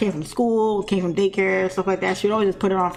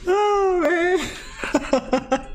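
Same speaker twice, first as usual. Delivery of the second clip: high, almost crying with laughter; real helpless laughter; 10 semitones higher than usual. Real, not acted. Storyteller worn out by his own story, helpless laughing. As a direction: sigh it out at the tail of a laughing fit. Cracks up after retelling.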